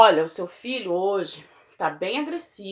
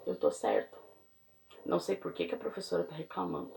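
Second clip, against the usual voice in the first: low, much softer sulky mumble; muttering child; 6 semitones lower, 10 dB quieter than usual. Retold or imitated. Child brushing off the teacher's complaint, sulky mutter. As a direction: grumble it like a kid who thinks he's right. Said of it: "Ele entende assim".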